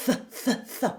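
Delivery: airy th bursts; voiceless th. Shown as a demonstration. Coach models voiceless th. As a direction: blow th between the teeth. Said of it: "Put the tongue between your teeth".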